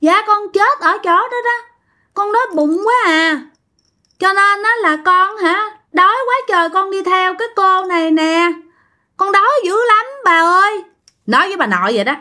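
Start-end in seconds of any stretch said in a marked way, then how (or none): 0.0-10.8 put-on high, pleading little child voice; high childlike whimpering plea; hungry child ghost. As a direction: beg grandma like a starving child. Child ghost begging grandma.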